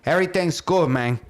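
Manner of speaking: mock Cuban accent; Scarface. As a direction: imitate a friend's fake Cuban gangster accent. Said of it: "talking like he's Scarface".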